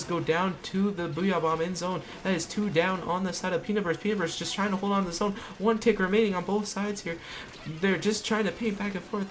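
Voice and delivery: high, fast, urgent calling; excited play-by-play. Real, not acted. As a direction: rush the call as overtime ends. Climax of overtime play-by-play.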